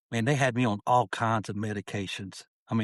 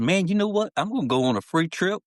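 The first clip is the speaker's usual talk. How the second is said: excited; 8 semitones higher than usual. Retold or imitated. Retold excited plan.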